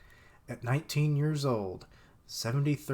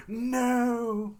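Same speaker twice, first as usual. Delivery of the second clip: dramatic no; 12 semitones higher, 4 dB louder than usual. Retold or imitated. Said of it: "And she's like".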